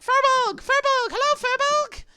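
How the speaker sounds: squealed, very high excited calls; little creatures calling out. Acted. Little Alliamites hailing a firbolg, high loud calls. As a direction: shout to the big visitor in a tiny high voice. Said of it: "you can see some of them, like".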